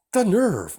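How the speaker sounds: high, loud hammy gasp of offence; mock outrage. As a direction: play the outrage big. Villain feigns offence.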